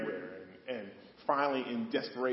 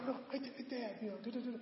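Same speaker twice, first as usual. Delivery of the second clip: higher, lightly voiced son pleading; Jonathan to his dad; 12 semitones higher, 7 dB quieter, much faster than usual. Jonathan pleading with his father Saul, higher. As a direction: play a son quickly excusing himself, light and higher. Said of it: "Jonathan said".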